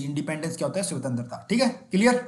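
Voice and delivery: ordinary delivery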